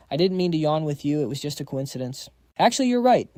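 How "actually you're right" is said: high, loud burst of agreement; sudden agreement. Acted. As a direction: jump in eagerly, much higher and louder. Empress turns in argument.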